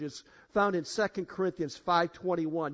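usual speaking voice

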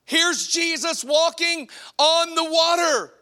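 high, loud proclaiming; preaching at full force. Acted. Preacher to congregation, loud and high. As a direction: declare it to the crowd.

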